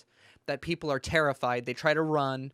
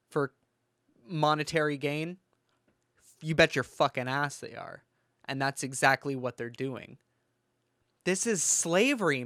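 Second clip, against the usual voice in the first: normal talking voice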